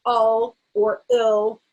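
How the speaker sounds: "ul" or "ill", each drawn out; two vowel variants. Shown as a demonstration. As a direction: slowly give "ul", then "ill". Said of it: "we say".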